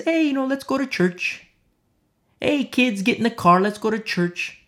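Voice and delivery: high-pitched imitation of an unsuspecting parent; parents calling kids. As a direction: call the kids along, pitched well up. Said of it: "all the time the parents".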